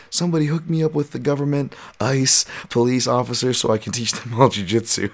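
high, mocking impression of Gordon Ryan. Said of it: "and he's like".

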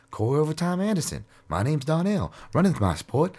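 high voice imitating Corey Anderson's way of talking; high mock impression of a fighter. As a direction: mimic the fighter doing the Donnell line. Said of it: "that's the way he talks".

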